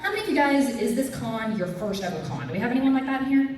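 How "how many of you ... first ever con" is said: usual speaking voice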